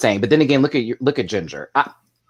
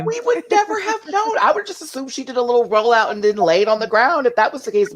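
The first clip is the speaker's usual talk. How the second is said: high sarcastic voice; 9 semitones higher than usual. Acted. Mocking the editing, high voice.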